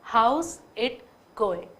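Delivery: very slow, falling How's it going; slow demo. Shown as a demonstration. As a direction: stretch it out and let it fall. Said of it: "देखिए मैं कैसे sound use कर रही हूँ". (धीमा, उतरता "How's it going?")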